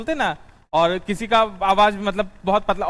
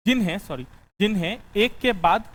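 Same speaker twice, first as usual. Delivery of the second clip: dictating notes. Acted.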